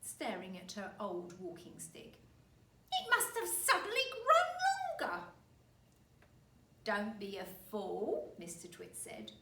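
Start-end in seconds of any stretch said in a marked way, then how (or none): 2.9-5.2 put-on high, loud old woman; old wife voice. 6.8-8.3 slow, loud and scornful; scornful husband. Acted. Husband scolds wife.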